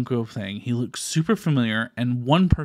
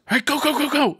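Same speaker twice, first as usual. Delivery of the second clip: urgent hurry-up call; 12 semitones higher, 4 dB louder than usual. Retold or imitated. Man lifts rope, rushes him in.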